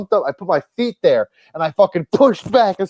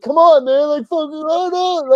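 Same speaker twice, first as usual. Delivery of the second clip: high, whiny protest from a shoved fan; mock whiny concertgoer; 10 semitones higher than usual. Buddy imitating pushy fans in the front row, high mock whine. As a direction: whine it like a fan getting elbowed, pitched way up.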